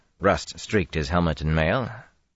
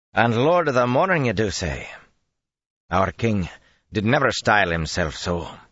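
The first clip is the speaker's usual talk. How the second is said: sceptical; 6 semitones higher, 3 dB louder than usual. Acted. Bowman questions the titles skeptically.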